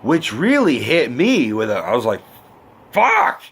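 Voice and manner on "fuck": very high-pitched sudden curse; struck outburst. Retold or imitated. Viewer hit by the mom's line, stunned outburst. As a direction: blurt it as if the line just hit you. Reacts to a line hitting home.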